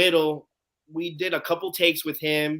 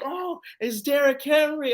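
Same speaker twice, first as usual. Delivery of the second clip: high, drawn-out awe at usual volume; star-struck; 10 semitones higher, slower than usual. Retold or imitated. Fan spots Derrick Henry walking up, star-struck. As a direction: replay the fan's amazement, slow and high.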